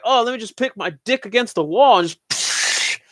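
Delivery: high-pitched goofy guy voice; mocking careless guys. Mimicking men who miss the toilet bowl, mocking. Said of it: "and they're like".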